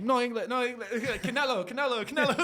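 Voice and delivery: very high, hammed-up Spanish accent; feigned Spanish-only speaker. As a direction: play clueless in a squeaky mock Spanish accent. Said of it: "I just wanted to be like".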